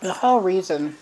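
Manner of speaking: muffled words around food; pausing to chew between phrases; talking while chewing. Real, not acted. Seen 0: Ham bitten at -5; closed puffed lips suggest chewing into +3.3.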